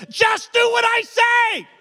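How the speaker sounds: very high, loud, slow shouted order; ironic "calmly" shout. Retold or imitated. Husband yelling at his wife over the mouse, loud and high. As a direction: bark the order, nothing calm about it. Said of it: "I calmly said".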